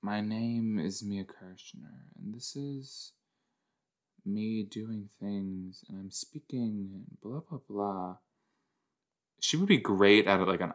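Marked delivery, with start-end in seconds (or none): 0.0-8.2 soft, slow, dreamy imitation of the actress; Mia Kirshner impression. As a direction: murmur it slowly and softly, like her calm screen voice. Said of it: "It's so like".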